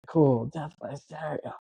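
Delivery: catchphrase drawn out slowly; slow, put-on movie quote; movie one-liner. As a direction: imitate an action hero's one-liner, taking your time. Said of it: "he's like".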